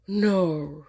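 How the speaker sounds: loud flat rejection; firm no. Retold or imitated. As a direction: shut it down hard. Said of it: "I was like".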